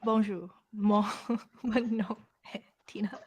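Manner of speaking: halting French accent broken by a laugh. Shown as a demonstration. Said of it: "Rate my French from one to ten".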